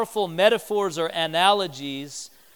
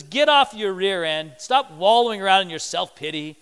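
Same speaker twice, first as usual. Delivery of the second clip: normal talking voice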